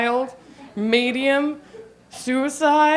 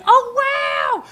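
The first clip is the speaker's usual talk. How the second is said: genuinely excited; 11 semitones higher than usual. Retold or imitated. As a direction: gush with delight. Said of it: "And I was like".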